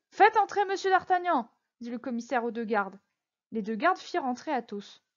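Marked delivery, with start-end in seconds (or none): 0.1-1.5 high, raised official summons; commanding. Acted. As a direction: call an order out to the guards. Commissioner calls guards to bring suspect in.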